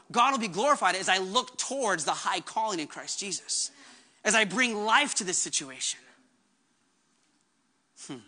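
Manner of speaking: normal talking voice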